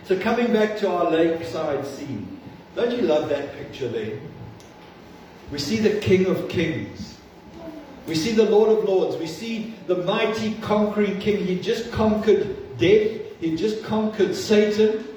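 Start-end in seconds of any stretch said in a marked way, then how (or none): 5.5-15.0 rising, higher preaching proclamation; climbing preacher's proclamation of the King; preaching crescendo. Acted. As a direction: build the praise up. Preaching builds to climax.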